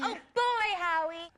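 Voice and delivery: very high, squealed catchphrase; playful squeaky send-off; catchphrase call. Pretend. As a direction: echo it back in a high voice. Farewell catchphrase called back.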